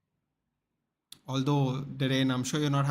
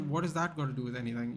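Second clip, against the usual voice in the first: fast defensive retort; faster than usual. Retold or imitated. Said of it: "He's like".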